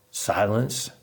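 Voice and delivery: ordinary delivery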